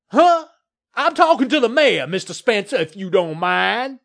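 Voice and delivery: loud, high blustering brush-off; irritated bluster. A narrator's reading. Developer rebuffing the landowner who interrupts, irritated bluster. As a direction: cut him off loudly, then draw out the end.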